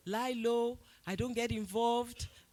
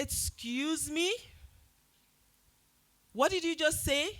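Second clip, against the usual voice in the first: indignant challenge; 8 semitones higher than usual. Retold or imitated. Confronting the stranger in the bookshop, indignant and high. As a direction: bristle with disbelief at what you just heard.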